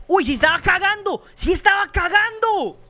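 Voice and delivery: high, loud shriek of delighted disbelief; shrieking surprise. Real, not acted. Gamer finds an enemy caught mid-poop, shrieking with glee. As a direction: squeal it like you can't believe your eyes. Discovers enemy really pooping.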